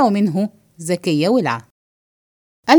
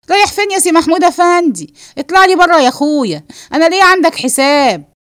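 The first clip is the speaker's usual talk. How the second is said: high, raised confrontational voice; scolding yell; 6 semitones higher, 5 dB louder than usual. Acted. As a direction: holler after him to stop him leaving. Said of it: "ما تزعقيش كده يا ست زكية". (زعيق بصوت عالي الطبقة)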